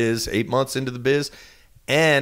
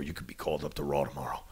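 hushed promise; 9 semitones lower, 13 dB quieter, faster than usual. Retold or imitated. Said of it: "I was either told".

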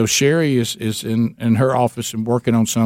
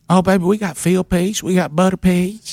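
much higher, sweet and doting; waitress voice; 6 semitones higher than usual. Retold or imitated. Waitress offering fried chicken to caller, high and doting. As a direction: dote on him like a favorite customer. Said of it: "hear her voice saying to me".

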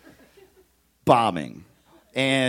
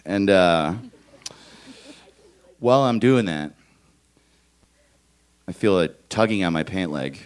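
ordinary talk

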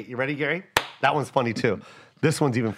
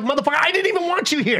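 fast, high comic snap; comic telling-off to a needy fan, fast and high; mock exasperation; 10 semitones higher, much faster than usual. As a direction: rattle it off pitched up and fed up. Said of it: "I'm like".

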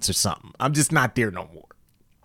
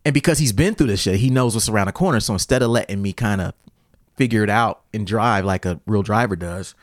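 ordinary talk

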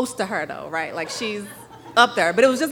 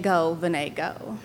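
soft, lower, tender echo of dad; tender memory; 4 semitones lower, 4 dB quieter, slower than usual. Retold or imitated. Daughter remembering her late father's cheer, soft tender echo. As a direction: say it gently like a cherished voice. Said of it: "the most beautiful voice in the world saying".

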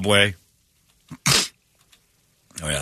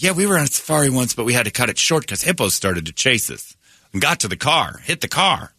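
higher-pitched, lightly put-on American tourist voice; oblivious tourist; 5 semitones higher than usual. Tourists recalling a hippo chase to their guide, chirpy oblivious voice. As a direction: play the oblivious tourist in a lifted, sing-out voice.

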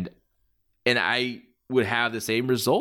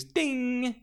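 bell ding; 11 semitones higher, 5 dB quieter than usual. Retold or imitated. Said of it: "just as the bell rings".

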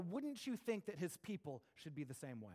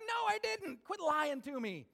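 indignant refusal; 10 semitones higher, 5 dB louder than usual. Retold or imitated.

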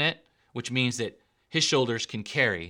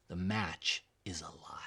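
breathy stage whisper, much softer; dramatic whisper; 6 semitones lower, 12 dB quieter than usual. Acted. Preacher whispering the reveal to the congregation, hushed.

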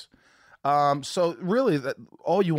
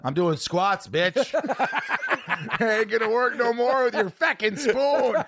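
put-on cocky kid taunt; 9 semitones higher, slower than usual. Voicing the kid taunting mom.

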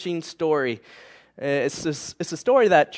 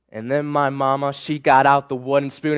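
usual speaking voice

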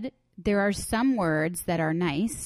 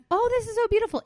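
much higher, sing-song fake admiration; mock gushing; much faster than usual. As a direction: gush over the art, overdone. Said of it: "I'm like".

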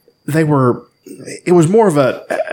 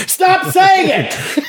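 high squealing shout, slowed; mock shout; much slower than usual. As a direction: yell it in exasperated mock fury.

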